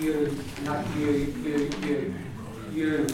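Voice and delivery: U with lips pushed out as if to kiss; teacher showing rounded U. Shown as a demonstration. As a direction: round and push the lips forward for U. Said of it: "move your lips out".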